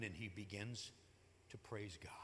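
hushed whisper. Acted. Preacher tells of Zacharias praising God, whispered in awe. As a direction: breathe it out in awe. Awed climax of the story.

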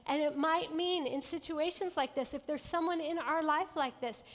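ordinary delivery

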